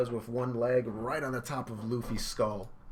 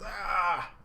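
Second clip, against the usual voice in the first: sharp mock cry of pain; 9 semitones higher, 4 dB louder than usual. Luffy yelping as he gets hit. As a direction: yelp as if kicked. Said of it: "Luffy goes".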